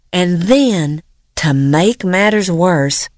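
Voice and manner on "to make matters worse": plain reading voice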